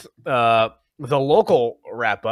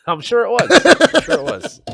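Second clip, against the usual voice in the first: teasing sarcasm; 12 semitones higher, 9 dB louder than usual. Real, not acted. Teasing cohost about blue soda.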